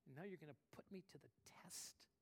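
whispered, soft reproach; hushed rebuke. Acted. God rebukes the doubting pastor, hushed whisper. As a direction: breathe the question like a secret. God's gentle rebuke, voiced.

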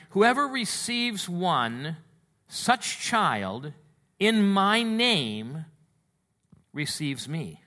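drawn-out stressed scripture phrases; solemn slow scripture emphasis; slow emphatic reading. Acted. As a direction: linger on each phrase.

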